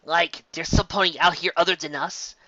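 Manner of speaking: ordinary delivery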